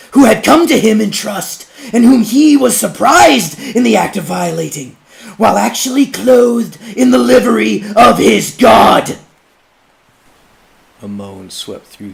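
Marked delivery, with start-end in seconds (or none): 0.1-9.2 high, loud orator's denunciation; judge's public denunciation. Acted. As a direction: thunder the accusation to the crowd.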